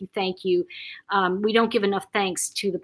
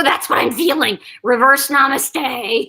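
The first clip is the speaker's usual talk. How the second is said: louder, slowing on the punchline; retold exclamation; 3 semitones higher, 7 dB louder than usual. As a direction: retell it as a loud, amused agreement. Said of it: "And I go".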